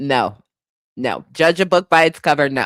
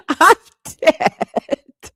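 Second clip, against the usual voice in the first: breathless laugh; 10 semitones higher than usual. Real, not acted.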